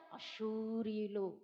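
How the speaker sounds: అశూరీయులు said low, soft and slow; low hushed name. Shown as a demonstration. Preacher naming the feared enemy, low and slow. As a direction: drop the voice and draw out అశూరీయులు. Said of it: "నొక్కి నొక్కి చెప్తున్నాను".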